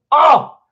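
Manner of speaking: high, loud "oh" burst; excited cry. Real, not acted. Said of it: "I'm freaking excited about this".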